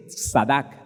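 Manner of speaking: tzadak said carefully, each syllable clear; Hebrew word read out. Shown as a demonstration. Preacher teaching the Hebrew root: careful tzadak. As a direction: spell it out then say tzadak slowly. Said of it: "sino T S A D A C".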